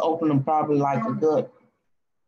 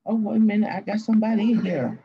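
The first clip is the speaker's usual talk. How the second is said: normal talking voice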